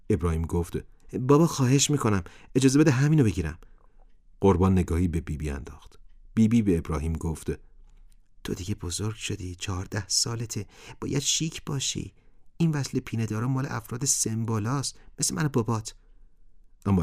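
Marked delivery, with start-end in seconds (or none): 1.2-3.6 much higher, louder pleading; a son begging his father; begging boy. A narrator's reading. 8.4-15.9 gentle mother. A narrator's reading. Mother gently persuades son.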